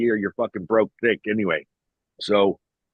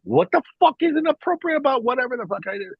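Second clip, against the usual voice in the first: higher, exasperated retort; indignant rant; 11 semitones higher than usual. Retold or imitated. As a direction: rant back in outrage.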